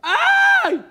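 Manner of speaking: sudden very high loud cry of pain "איי"; pained yelp. Retold or imitated. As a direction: yelp out sharply as if stung. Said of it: "אז הוא צעק". (צעקת כאב חדה וגבוהה)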